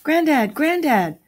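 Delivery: put-on high, loud childlike call; young children. A narrator's reading. Two children announce their arrival to Granddad, high young voices. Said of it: "sound of two young voices".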